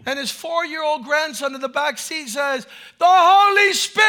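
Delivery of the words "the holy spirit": loud, high excited cry; high excited yell of a child; child's shout. Retold or imitated. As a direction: shout it out like an eager little boy. Grandson shouting in back seat.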